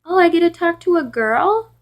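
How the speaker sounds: mock-thrilled caller, high and loud; high, loud, gushing mock question; creepy caller imitation. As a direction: imitate a creep delighted to reach a girl. Retelling a creepy caller's words.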